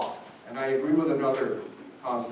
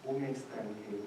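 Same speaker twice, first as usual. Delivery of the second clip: quiet echo; 4 semitones lower, 12 dB quieter than usual. Real, not acted. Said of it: "repeated for weight".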